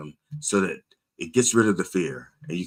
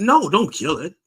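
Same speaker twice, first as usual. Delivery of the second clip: high, louder objection; retold protest; 10 semitones higher, 6 dB louder than usual. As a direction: object sharply in a raised pitch. Protesting to animal control, retold.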